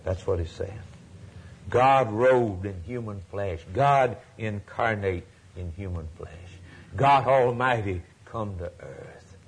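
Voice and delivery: normal talking voice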